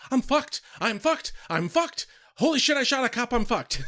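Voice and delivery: high, loud mock panic, hammed up; imitated freak-out. Acting out George realising he shot a cop, hammed-up panic. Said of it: "he's still freaking out".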